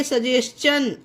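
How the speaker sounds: सजेशन said "sa-jes-chun"; ch sound for T. Shown as a demonstration. Teacher showing an example, सजेशन with "ch". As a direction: say सजेशन with "ch" after the s. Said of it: "तो क्या पढ़ेंगे".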